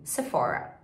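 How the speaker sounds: "Sephora" with English R; English pronunciation. Shown as a demonstration. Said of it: "Em inglês é".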